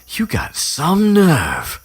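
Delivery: high, loud, drawn-out indignation; mock outraged snap. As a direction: stretch it out in hammed-up outrage. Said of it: "then Ron, like, snaps at her".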